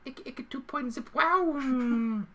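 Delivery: high, slow, drawn-out comic voice; silly knight voice. Acted. Joining in on Monty Python bits, high silly knight voice.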